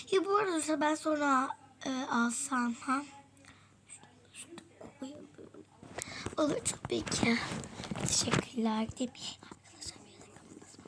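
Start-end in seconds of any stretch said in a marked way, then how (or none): 3.9-10.8 very soft whisper. Real, not acted. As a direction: hush it, almost silent. Hiding, whispering.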